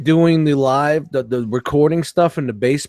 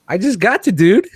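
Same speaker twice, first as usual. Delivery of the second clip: high-pitched bad impression ending in a laugh; badly imitated excitable high voice with high laughter; Yule; 11 semitones higher, 5 dB louder than usual. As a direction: squeal it up high, then crack up laughing. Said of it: "That's my bad Yule impression".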